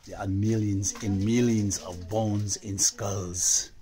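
ordinary talk

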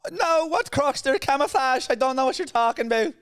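very high, loud, indignant Irish robber; put-on Irish falsetto, shouted; high-pitched Irishman. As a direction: deny it in a squealing Irish disguise voice. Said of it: "high-pitched Irishman".

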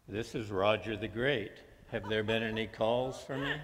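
ordinary talk, nothing marked

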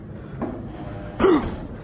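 sudden frightened intake of breath; sharp startled gasp. Real, not acted. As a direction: gasp in shock. Startled by noise in attic.

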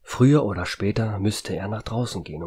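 usual speaking voice

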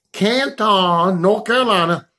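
ordinary delivery

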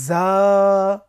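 জা said loud with a hiss of air behind the teeth; hissing Arabic za. Shown as a demonstration. Teacher demonstrating the letter জা to viewers. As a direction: blow air through জা, held and loud. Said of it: "শীষ দিতে হবে বা বাতাস দিতে হবে".

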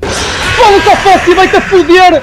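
loud, high frantic cursing; panicking gamer. Real, not acted. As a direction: curse in panic. Foxy attacks in game.

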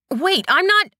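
cut-off frightened plea; alarmed protest. A narrator's reading.

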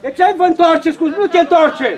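loud coach's telling-off; shouted reproach. Real, not acted. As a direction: shout at the players to correct them. Coach scolds players for turning. (reproș strigat de antrenor)

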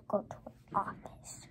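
low whispered muttering to oneself; hushed, voiceless aside; whispering to self. Real, not acted. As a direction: whisper it privately, under the breath. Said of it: "I was whispering".

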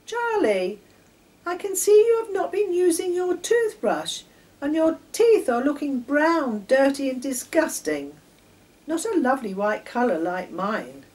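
put-on high, loud, bossy little-girl voice; superior girl. A narrator's reading. Girl scolding her cousin Charlie, bossy and loud. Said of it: "feeling very superior".

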